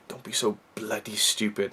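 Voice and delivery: a light Welsh lilt as he quotes the actor; Welsh accent. Retold or imitated. Quoting Donald Crisp's line, lightly Welsh. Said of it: "He tapped into it there".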